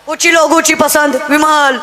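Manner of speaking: slow, drawn-out parody of a TV ad; mock ad slogan. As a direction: croon it like a cheesy commercial. Quoting a gutka ad jingle.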